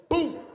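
explosieve uitroep; boom. Retold or imitated. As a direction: shout the boom like a blast. Explosion sound in stomach.

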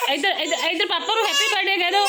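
high, quick, loud coaxing; urging call. Real, not acted. Mother urging child to wish papa happy birthday, urging call.

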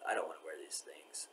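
half-whispered, mostly breath; whispered aside. Real, not acted. To the camera, whispering about hating the clothes. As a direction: let it slip out under the breath.

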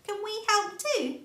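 very high, loud small hedgehog; an eager little one asking. A narrator's reading. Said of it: "Little Hedgehog asked".

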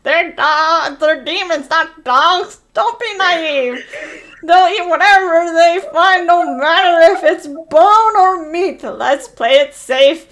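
fretful witch pleading for safety; panicky witch. Acted. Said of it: "said in a panicky voice".

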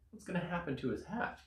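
ordinary delivery, nothing marked

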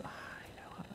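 breathy hush, almost silent; hesitant man whispering his answer; whispered. Retold or imitated. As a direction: drop to a bare whisper. Hesitant reply retold in a hush.

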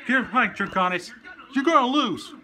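Wild Wing voice. Acted. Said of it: "fan dubbing as Wild Wing".

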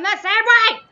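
sudden high, very loud shout; outburst. Retold or imitated. Streamer acting out snapping over tested patience, loud outburst.

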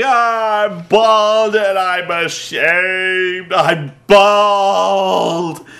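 mock sobbing lament, drawn out; mock weeping. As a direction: whine it like a crybaby in shame. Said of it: "Punk just starts weeping".

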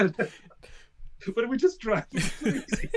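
real laughter at the memory; laughing. Real, not acted. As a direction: let the laugh take over. Laughing while telling prank.

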